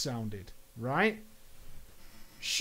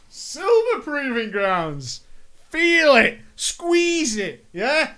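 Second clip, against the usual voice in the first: loud, high, drawn-out taunting hype; hammed-up victory taunt; mock bragging; 7 semitones higher, 4 dB louder, slower than usual. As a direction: gloat with over-the-top swagger, stretching the words. Mock hype, taunting her with achievement.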